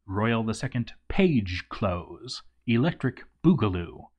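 ordinary delivery

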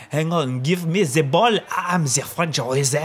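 mock French accent, slow at the end; French accent impression. Ben Arfa boasting about himself, in a mock French accent. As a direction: ham up a French footballer's accent. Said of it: "that's exactly how he sounds as well".

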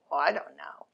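hushed mock shrug; child whispering I don't know; whispered unsure reply. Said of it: "And you go".

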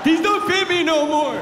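put-on high, loud childish complaint; whiny kid voice. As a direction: whine like a little boy. Son voiced in wrong pajamas.

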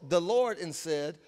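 ordinary talk

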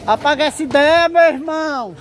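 loud teasing call; playful shout. Real, not acted. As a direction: shout it out jokingly. Joking shout at friend.

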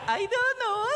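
squeaky-high imitation of a young woman's answer; mock clueless reply. Preacher imitating women asked about eyebrows, mock clueless. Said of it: "And I asked them".

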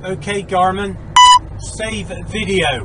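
slow, careful words for the device; slow clear command. Real, not acted. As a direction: spell it out slowly for the dashcam. Voice command to dashcam.

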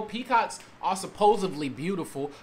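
ordinary talk, nothing marked

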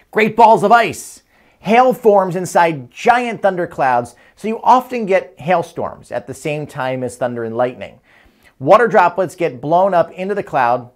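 excited picture-book reading; reading to a toddler. Acted. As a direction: read it big for little kids. Reads a children's book aloud.

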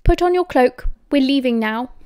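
forceful imperious demand; stern command. A narrator's reading. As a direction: order him out, allowing no argument. Angry mother orders son to leave.

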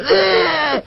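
high-pitched mock crying wail. Narrator mimicking the crying dwarfs, mock wail. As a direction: let out a high, exaggerated bawl.

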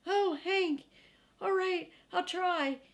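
high, frantic little-dog voice; excited young dog babbling alarm; squealing panicky dog. Acted. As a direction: pipe it up high like a scared pup. Drover's squeaky voice again.

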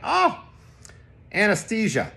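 high loud oh, then the word; a gleeful high know-it-all oh; eager exclaim. Retold or imitated. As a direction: pounce on the word brightly. Jokey reply to nurse.

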